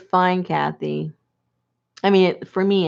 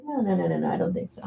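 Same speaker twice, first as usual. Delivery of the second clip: quiet refusal; 8 dB quieter, much faster than usual. Retold or imitated. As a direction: murmur a gentle refusal. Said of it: "I said".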